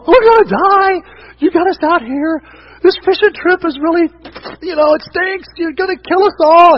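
high, loud panicked cry; mock-terror shout; panicked disciples. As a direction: wail it like terrified fishermen. Disciples complain to Jesus in storm.

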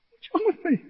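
high, tearful mock squeak; choked up. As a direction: act out being suddenly overcome with tears. Acts out being choked up.

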